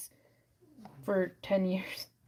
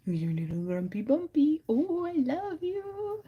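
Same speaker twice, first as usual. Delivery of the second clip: drawn-out coo rising high at the end; 7 semitones higher than usual. Real, not acted. Cooing lovingly at a little grumpy pet, baby talk. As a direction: gush slowly, climbing into a high pitch.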